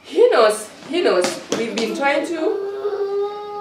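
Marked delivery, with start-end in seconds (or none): 1.6-3.6 real tears catching the words, drawn out; crying. Real, not acted. Hurt wife breaking down over her husband's denials, crying. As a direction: voice breaking into a slow, high, tearful sob.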